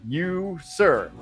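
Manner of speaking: normal talking voice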